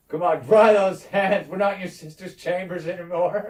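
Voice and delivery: mock goading shout. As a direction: heckle the rope team, amused. Acted lord goading the rope pullers.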